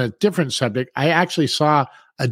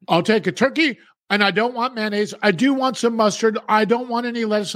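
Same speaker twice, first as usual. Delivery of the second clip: higher, picky mock order; fussy customer voice; 7 semitones higher than usual. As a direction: list it like a demanding customer. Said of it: "imitating a fussy customer".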